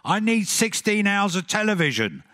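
Bob's table-banging demand. Retold or imitated. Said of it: "Bob kept banging the table saying".

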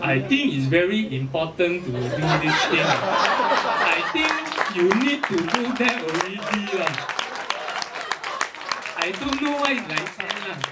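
Singlish swinging up and down in pitch; exaggerated seesawing Singaporean accent; zigzag Singlish. Shown as a demonstration. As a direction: zigzag through the sentence, Singlish style. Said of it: "zigzag pattern with how you speak".

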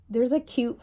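ordinary talk, nothing marked

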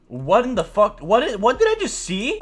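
high, stunned, slowed-down disbelief; shocked disbelief. Real, not acted. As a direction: react like you can't believe your eyes. Trap pulled on him, shocked.

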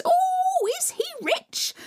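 very high, squealing and eager; greedy stepsisters. Acted. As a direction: gasp with greed.

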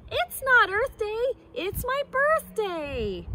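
high, proud monkey voice with NOT jumping up; proud silly monkey. Acted. Silly monkey brags to the jungle, high and proud. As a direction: crow it like a cheeky monkey.

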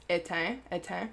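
éteint said with its final letters silent; French pronunciation. Shown as a demonstration. Showing viewers how to pronounce the product name, éteint in French. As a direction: say éteint the French way, ending on a nasal vowel. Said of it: "that's how you pronounce it".

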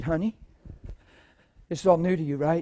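soft, low, tender; gentle reassurance. Retold or imitated. Preacher gently reassuring Tammy, soft and tender. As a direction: reassure her kindly. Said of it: "I said".

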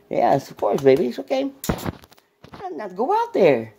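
very high sing-song coaxing; high coaxing pet voice. Real, not acted. Urging the dog Lulu to go out, high baby talk.

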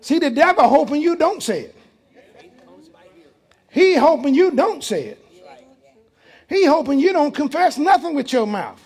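loud, high preaching call; preaching at full force. Real, not acted. Pastor warning the congregation about the devil, loud preaching. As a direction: drive the point home to the pews.

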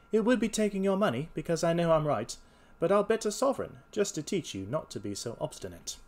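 high, loud, self-assured detective; confident detective. Acted. Holmes takes up the bet.

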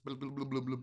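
low burbling underwater blahs. Shown as a demonstration. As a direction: blub it out like you're underwater. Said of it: "this is kind of my underwater voice".

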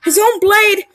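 loud high cry of shock; shocked exclaim. Real, not acted. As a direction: blurt it out amazed. Shocked by the game.

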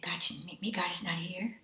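hushed, voiceless despair; whispered inner despair. Retold or imitated. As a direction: breathe it out in hopeless fear. Despairing thoughts retold in whisper.

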